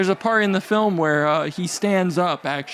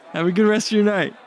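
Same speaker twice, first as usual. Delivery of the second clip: ordinary talk, nothing marked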